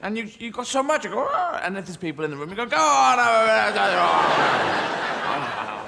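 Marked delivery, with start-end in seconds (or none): 1.3-1.6 pleased ah. Acted. Sigh of satisfaction. 2.6-5.8 pushy offer. Acted. Offering satsuma segment.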